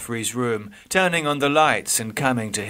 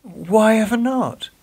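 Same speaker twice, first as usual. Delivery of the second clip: higher, gently amazed question; surprised nurse; 12 semitones higher, 4 dB louder than usual. Acted. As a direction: ask in kindly surprise.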